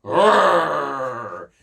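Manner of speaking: playful growling roar. Acted. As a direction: roar like a lion. Sound effect for strong.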